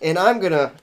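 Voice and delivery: ordinary delivery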